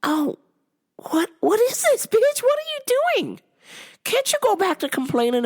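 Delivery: shrill high comeback; outraged disbelief. Retold or imitated. Snapping back at the customer in high outraged disbelief. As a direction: squeak it out in indignant shock.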